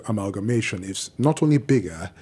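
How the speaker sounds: ordinary talk, nothing marked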